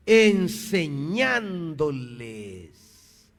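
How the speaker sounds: "enseñándoles" stretched very slowly, syllable by syllable; drawn-out emphasis. Real, not acted. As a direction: hammer home "enseñándoles" by drawing out every syllable. Recalca la palabra clave del versículo. ("enseñándoles" alargado, sílaba por sílaba)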